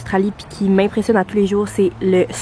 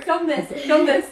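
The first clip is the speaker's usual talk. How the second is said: ordinary talk, nothing marked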